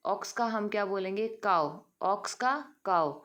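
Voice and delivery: ordinary talk, nothing marked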